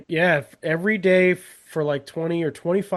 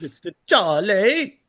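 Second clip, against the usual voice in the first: put-on British accent; 5 semitones higher, 4 dB louder, slower than usual. Quoting the Charlie line.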